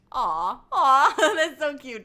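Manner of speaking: high, loud gushing aww with a laugh; delighted aww. Real, not acted. Gushing to a viewer about a cute cat photo, high and loud with a laugh. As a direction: melt over a cute kitten picture.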